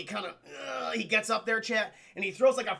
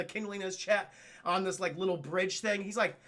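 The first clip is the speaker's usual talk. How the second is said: ordinary talk, nothing marked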